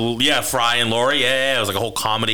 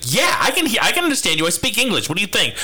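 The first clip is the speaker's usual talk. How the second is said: high mock Scottish accent, a bit bitchy; mock Scottish lady snapping back; imitating a Scottish accent; 7 semitones higher than usual. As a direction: play the Scottish grandmother, indignant, high. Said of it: "she hits me with the Scottish accent".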